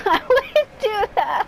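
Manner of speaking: high, slow, loud goofy voice; silly high voice. Pretend. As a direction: stretch it out in a squeaky joke voice. Repeats line in silly voice.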